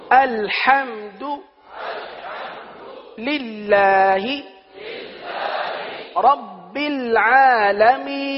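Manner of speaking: الحمد للہ رب العالمین, slow, du clearly rounded; corrected recitation. Shown as a demonstration. Teacher models the right reading word by word. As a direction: spell out the vowel as du. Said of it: "دو".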